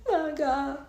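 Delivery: high, crying wail of mock panic; mock panicked cry. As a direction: wail it like it's the end of the world. Panics at parasite news.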